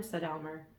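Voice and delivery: ordinary delivery, nothing marked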